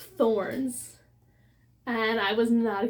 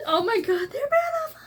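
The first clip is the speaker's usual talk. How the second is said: much higher, bursting cry of delight; excited squeal; 12 semitones higher, 3 dB louder, faster than usual. Real, not acted. Unboxing a special edition for viewers, squealing with delight. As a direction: squeal it like you can't contain yourself. Sees book two's sprayed edges.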